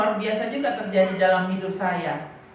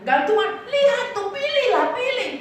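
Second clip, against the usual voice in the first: shopkeeper urging; 10 semitones higher than usual. Retold or imitated. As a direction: play the pushy seller egging the kids on. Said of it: "yang jualan tuh bilang begini".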